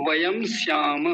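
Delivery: usual speaking voice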